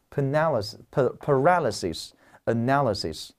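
Paralysis and analysis stressed on the same syllable; match the stress of paralysis to analysis; stress pattern demo. Shown as a demonstration. Teacher shows students paralysis and analysis share stress. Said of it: "注意，重音位置完全一样".

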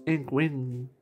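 normal talking voice

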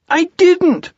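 very high, loud little protest; indignant tiny creature voice; squeaky Piglet. A narrator's reading. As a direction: squeal the denial.

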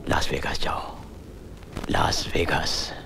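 soft, near-whispered words trailing away; low, breath-soft murmur; hushed send-off. Acted. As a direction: let the voice sink almost to a whisper. Father sends son off, voice sinking.

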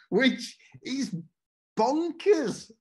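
high laughing disbelief on bonkers. Real, not acted. Telling the audience his life story is bonkers, laughing. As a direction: laugh it out, amazed at his own luck.

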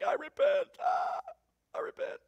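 high, squeaky cowed surrender, shrinking to softness; Job. Acted. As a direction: give in like someone overwhelmed, fading away. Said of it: "And Job's like".

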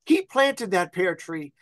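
put-on fond old neighbor reminiscing; little old lady. Old lady showing the visitor the pear tree, high and fond. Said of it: "She goes".

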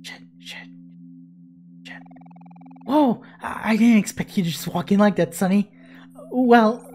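usual speaking voice

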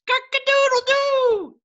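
very high, loud cock-a-doodle-doo; rooster crow. Shown as a demonstration. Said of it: "Then the rooster".

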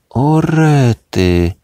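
high, loud, drawn-out "O rety!" in a cartoon voice; excited exclamation. A narrator's reading. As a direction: gasp it out, slow and thrilled. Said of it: "wykrzyknął Kudłaty". (podekscytowany, przeciągły okrzyk)

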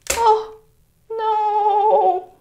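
high, loud oh no; dismayed cry. Real, not acted. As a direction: yelp in dismay. Clip just fell.

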